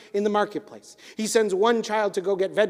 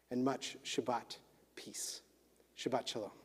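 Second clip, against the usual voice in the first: quiet blessing; 6 semitones lower, 4 dB quieter than usual. Real, not acted. Rabbi blesses listeners for Shabbat, soft fading benediction. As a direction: bless them gently, letting it fade.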